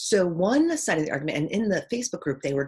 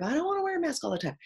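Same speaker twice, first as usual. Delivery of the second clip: mock whiny; 11 semitones higher, faster than usual. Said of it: "I'm more on the side of".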